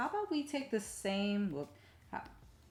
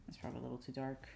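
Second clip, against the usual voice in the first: ordinary talk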